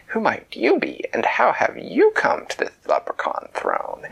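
high, haughty royal voice; lofty little queen, high-pitched; leprechaun queen. Acted. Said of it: "someone clear their voice".

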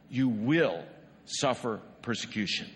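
ordinary talk

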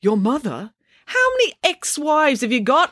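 put-on high, indignant girl's question; a voiced teenage daughter, incredulous. A narrator's reading. Girl voiced in story.

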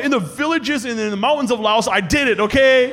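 loud, high indignant brag; telling the uncles off, loud; defiant proud boast. Retold or imitated. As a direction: fire it back proudly. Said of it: "I'm like".

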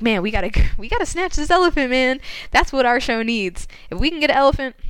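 mock urgent enthusiasm; acted-out eagerness. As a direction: imitate an excited boss talking them into it. Uncle Al urges buying elephant.

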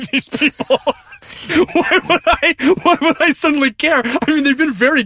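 high laughing protest; words squeezed out through laughter. Real, not acted. Laughing protest about new family.

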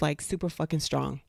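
usual speaking voice

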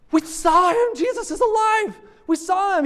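high, loud, breathless news; excited disciples. Acted. Disciples bursting to tell Thomas, high and excited. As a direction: blurt it out like they can't believe it.

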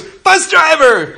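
high, loud "Bus driver!" called out with joy; girl running up, shouting happily; excited shout. Retold or imitated. Girl greeting her bus driver, excited high shout. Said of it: "runs up to me and goes".

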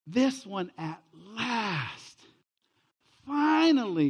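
slow, high, building to a loud "finally"; Adam finally sees his wife, overjoyed; awed delight. Retold or imitated. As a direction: let the relief swell into joy. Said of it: "He says this".

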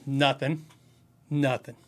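softly deadpan, giving nothing away; imitated guarded suspect. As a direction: act like you're being questioned at a traffic stop. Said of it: "Like I was being a traffic stop".

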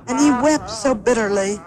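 ordinary delivery

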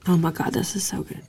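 breathy whispered delight; hushed, savoring the taste; savoring delicious food. Real, not acted. Tasting the beer, whispered delight.

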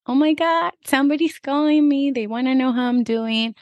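high-pitched, delighted mock reaction; excited. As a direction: act thrilled to get a surprise phone call. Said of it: "I think people get excited".

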